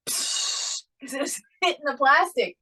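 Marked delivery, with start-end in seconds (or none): 0.1-0.8 imitated hissing pee. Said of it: "So I heard".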